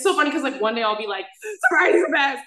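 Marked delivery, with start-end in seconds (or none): 1.7-2.4 loud, higher-pitched gung-ho cheer; imitating one's own overjoyed self; mock elation. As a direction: proclaim it with hammed-up enthusiasm. Acting out a good day.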